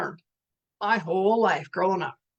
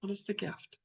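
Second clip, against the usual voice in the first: "What is the gift?" asked again much softer, almost to herself; softer repeat; 14 dB quieter than usual. Shown as a demonstration. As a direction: let the question sink in, quietly. Repeated question, dropped to near-whisper.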